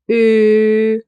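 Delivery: "Ö" drawn out on one steady note; long demonstration "Ö"; held German Ö. Shown as a demonstration. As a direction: hold "Ö" long, lips rounded, tongue as for E. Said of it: "form your lips like you want to say the O".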